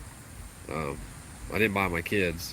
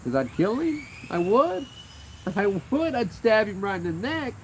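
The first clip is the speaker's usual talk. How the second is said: much higher, louder cutesy threat; mock threat, sing-song; 12 semitones higher, 3 dB louder than usual. Real, not acted. Owner joking to the dog about the kids, very high cutesy voice.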